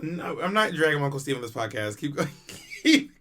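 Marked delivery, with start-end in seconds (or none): 2.6-3.1 breaking into laughter, higher; laughing. Real, not acted. Waving co-host on after refusing to drag uncle, laughing. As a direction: laugh through the words.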